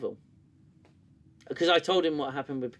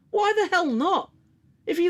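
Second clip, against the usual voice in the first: much higher and louder, outraged; exasperated rant; 5 dB louder than usual. Real, not acted. Ranting about channels ignoring The Orville, exasperated. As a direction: throw the question out in disbelief.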